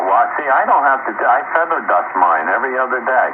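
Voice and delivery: ordinary delivery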